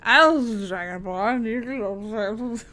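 lightly voiced slow slurred old wizard; drunk Dumbledore. Streamer imitating a drunk character, slurring. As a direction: slur it like a tipsy old wizard. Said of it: "That's what he sounds like".

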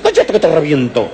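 half-joking furious parent; hammed-up angry parent hissing at his kid; mock threat to child. Embarrassed parent scolds child.

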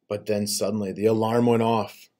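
ordinary delivery, nothing marked

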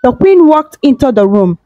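ordinary delivery, nothing marked

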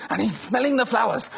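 normal talking voice

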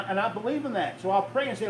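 usual speaking voice

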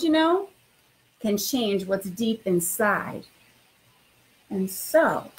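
expressive storytime read-aloud; reading to children. Acted. Principal reading a picture book to new students, lively storytime voice. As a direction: read it like bedtime story time for little kids.

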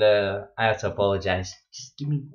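ordinary delivery

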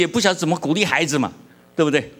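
normal talking voice

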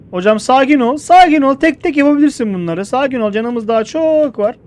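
high, loud urgent pleading; frantic calming. Real, not acted. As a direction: beg them to calm down. Panikleyen arkadaşı sakinleştiriyor. (telaşla yalvararak)

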